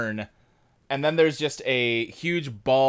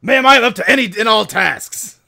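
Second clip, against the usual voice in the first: loud, higher military declaration; gung-ho soldier; 9 semitones higher, 10 dB louder than usual. Acted. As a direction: bark out eager readiness to a superior. Soldier insists he is ready.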